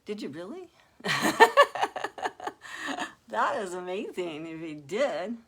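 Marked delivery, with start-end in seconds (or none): 1.0-3.2 high, loud burst of laughter; delighted laugh. Real, not acted. Streamer thrilled by a viewer's gift, high laughing.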